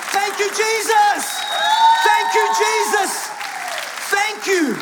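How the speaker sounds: high, drawn-out shout of praise; yelled thanks. Shown as a demonstration. Preacher showing church the thanks Jesus deserves, yelling. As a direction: yell it out to heaven. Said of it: "He deserves a yell".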